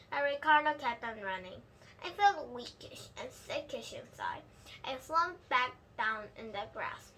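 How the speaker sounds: usual speaking voice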